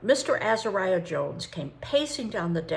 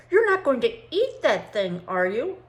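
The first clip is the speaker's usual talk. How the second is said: startled, gasping question in a higher voice; gasped; 5 semitones higher, 7 dB louder than usual. Acted. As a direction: gasp it out in shock. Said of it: "Mister Azariah Jones gasped".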